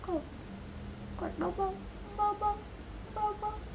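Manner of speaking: very slow, high, soft voice; gently. Shown as a demonstration. Vlogger demonstrates pressing lightly, very slow and soft. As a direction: draw out เบาๆ as softly as a touch.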